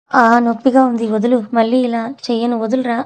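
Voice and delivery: loud, pleading cry of pain. Acted. Sweety crying out as Srikar grips her wrist, loud. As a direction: yelp to be let go. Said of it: "అని అరుస్తుంది".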